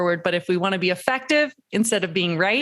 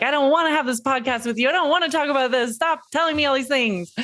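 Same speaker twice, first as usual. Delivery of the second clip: higher, fast, whiny protest; mock sulky pushback, a bit higher and quicker; resisting guest voiced; 6 semitones higher, faster than usual. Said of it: "You're like".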